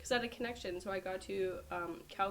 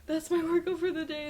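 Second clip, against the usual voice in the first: high, loud and laughing; laughing breathless; 10 semitones higher, 10 dB louder than usual. Retold or imitated. Telling gate staff about her sprint, laughing high. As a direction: blurt it out through laughter.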